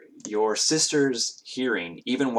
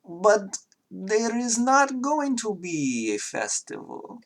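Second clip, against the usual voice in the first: put-on high, slow Russian accent, face clouding; Russian girl, downcast and slow; sad Russian girl; 12 semitones higher, slower than usual. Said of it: "She says".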